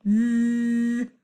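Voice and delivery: chainsaw buzz with tongue between teeth; voiced th demo. Shown as a demonstration. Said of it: "what I call a chainsaw sound".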